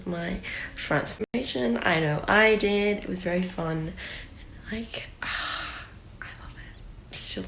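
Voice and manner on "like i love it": slow sighing whisper. Real, not acted. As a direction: breathe it out softly.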